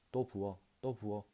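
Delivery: put-on understated drunken call. A narrator's reading. Drunkard demanding another drink, mildly calling out. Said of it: "라고 외쳤다".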